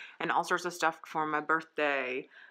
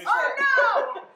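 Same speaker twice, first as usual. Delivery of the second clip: high, loud "oh no"; dismayed cry; 7 dB louder than usual. Real, not acted. Birthday woman reacting to the cake, dismayed cry.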